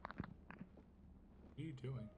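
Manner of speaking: very quiet, drawn-out whispered question. Real, not acted. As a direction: whisper it slowly so nothing picks it up. Keeping under the microphone threshold.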